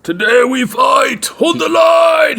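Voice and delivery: slow, high Scottish battle cry shout; rally the troops, roaring slowly; roaring rallying speech. Pretend. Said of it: "should call the troops to war now".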